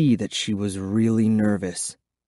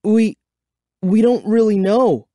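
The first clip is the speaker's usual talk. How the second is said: high, louder, halting start; stammered; 11 semitones higher, 5 dB louder than usual. A narrator's reading. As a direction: stumble on the first word and restart. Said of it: "I stammered".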